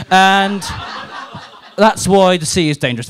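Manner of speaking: ordinary talk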